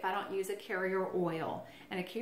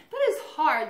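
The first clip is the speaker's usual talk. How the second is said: exclaiming; 8 semitones higher, 9 dB louder than usual. Real, not acted. Laughing at own rambling.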